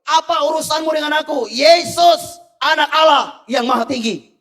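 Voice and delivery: the demon cries out to Jesus, loud and high; loud cry. Retold or imitated. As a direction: yell it at Jesus like a frightened demon. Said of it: "dan berkata dengan keras".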